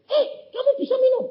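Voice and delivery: astonished. Retold or imitated. Nurse amazed that the patient can drink, high and fast. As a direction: jump up in disbelief.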